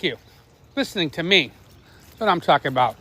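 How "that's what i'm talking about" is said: ordinary talk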